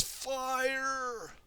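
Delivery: high drawn-out call; element cry. Acted. Kai calling his element for the tornado, high cry. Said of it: "Kai says".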